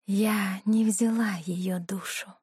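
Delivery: distant whispered demon echo; distant flashback echo. Acted. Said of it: "далёким эхом звучал в голове голос демона".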